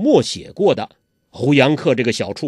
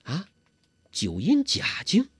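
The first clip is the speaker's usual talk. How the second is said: soft puzzled question; 4 semitones higher, 5 dB quieter than usual. Acted. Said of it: "低声问".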